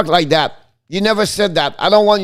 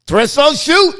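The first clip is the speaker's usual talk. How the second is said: excited call; 9 semitones higher, 3 dB louder than usual. Real, not acted. Shot during live call.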